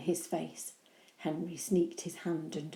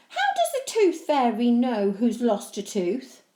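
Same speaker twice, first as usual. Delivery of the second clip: curious; 5 semitones higher, 8 dB louder than usual. Acted. Henry asking innocently.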